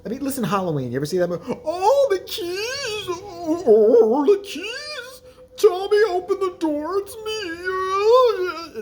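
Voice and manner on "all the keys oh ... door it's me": very high, slow, sobbing, strained scream-queen wail; over-the-top tearful falsetto, stretching out the keys; Jamie Lee Curtis impression. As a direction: ham up her crying plea at the door. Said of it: "She kinda sounded".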